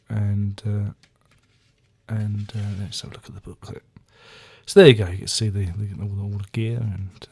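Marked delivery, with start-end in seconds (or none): 2.8-3.8 fast whispered aside. Real, not acted. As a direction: mutter it under your breath. Aside muttered.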